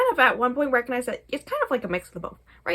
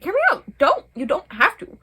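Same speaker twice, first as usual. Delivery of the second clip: much higher and louder, mock urgent; eager pleading; 8 semitones higher, 8 dB louder than usual. Fiance urging her not to handle the family, high loud mock plea. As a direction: jump in urgently to stop her.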